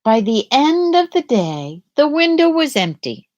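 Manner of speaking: ordinary delivery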